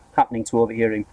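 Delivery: plain reading voice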